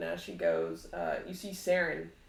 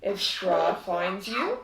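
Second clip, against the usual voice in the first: slow, loud, mocking villain voice; taunting villain; 7 dB louder, slower than usual. Azalin taunts the party, slow and loud. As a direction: taunt them like a game. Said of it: "Azalin laughs and says".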